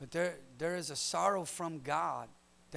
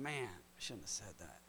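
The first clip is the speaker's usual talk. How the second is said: regretful mutter; 9 semitones lower, 11 dB quieter than usual. Retold or imitated. Regretful self-talk after speaking.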